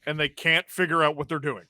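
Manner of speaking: usual speaking voice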